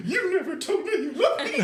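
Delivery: high, laughing mock of the line; mocking through laughter. Retelling the brother's teasing, giggling and mocking. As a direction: mimic the brother teasing while cracking up. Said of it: "then my little brother was like".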